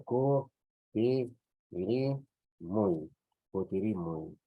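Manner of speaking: ku, ti, ri split with pauses, then the whole word; teacher dictating syllables. Shown as a demonstration. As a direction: break kutirimu into syllables for writing. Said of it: "entonces es la U ahora".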